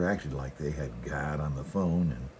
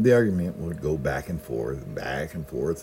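ordinary delivery

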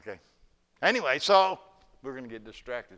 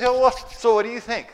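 high, bright-eyed abbot asking, hammed up; cheery abbot; 10 semitones higher, 10 dB louder than usual. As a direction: play the beaming abbot, voice lifted high and loud. Abbot voiced in the story.

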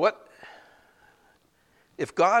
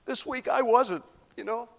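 1 raised confession; 8 semitones higher, 5 dB louder than usual. Real, not acted.

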